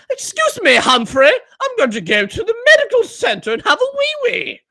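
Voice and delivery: high-pitched mock posh schoolboy; posh school kid. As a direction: put on a posh child's accent, high. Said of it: "like a posh school kid would say".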